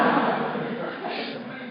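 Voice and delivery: laughing. Real, not acted. Laughing at Struggle Puffs joke.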